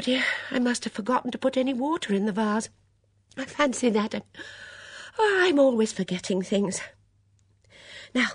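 high, fluttery, flustered voice; fretful Dora. A narrator's reading. Dora scolds herself over forgotten flowers, flustered. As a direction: fuss along in a high voice.

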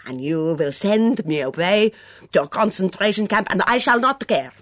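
put-on Mitzi, hunted and defiant, in a heavy foreign accent; a suspicious foreign cook in a thick accent, rising to outbursts. Mitzi voiced by narrator.

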